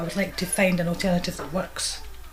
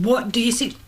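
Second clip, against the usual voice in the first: mock stern demand; 5 semitones higher, 6 dB louder than usual. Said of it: "And John says".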